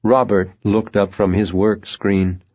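ordinary delivery

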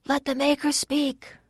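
high-pitched call without much volume; thin-volume, high plea from the people; crowd cry. A narrator's reading. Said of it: "people cried".

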